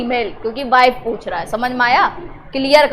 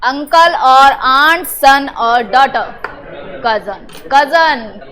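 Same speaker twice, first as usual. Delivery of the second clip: slow drill prompt; much slower than usual. Shown as a demonstration. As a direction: drag it out so the class can answer together. Teacher repeats prompt for class.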